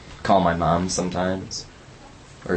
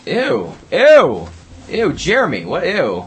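loud high disgusted ew; 9 semitones higher, 7 dB louder, slower than usual. Real, not acted. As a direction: recoil from something gross. Disgusted at found apple.

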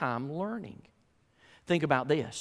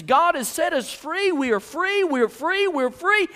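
high, loud mock rejoicing; hammed-up shouts of thanks; jubilant praise; 13 semitones higher, 8 dB louder than usual. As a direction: cry out freedom, acting a liberated crowd. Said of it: "Man, singing praises".

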